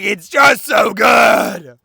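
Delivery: loud, drawn-out gushing yell; ecstatic shout. Retold or imitated. Reliving a rewatch of The Dark Knight, ecstatic shout. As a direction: shout it slowly, overwhelmed with enthusiasm. Raving about a rewatched favorite film.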